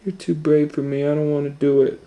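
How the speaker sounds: shy little sister backing out; timid little sister. Acted. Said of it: "Louise was afraid to".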